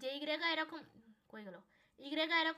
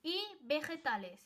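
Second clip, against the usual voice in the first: 1 careful slow demo; 2 semitones higher, 4 dB louder, much slower than usual. Shown as a demonstration. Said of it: "তো আমি বলবো".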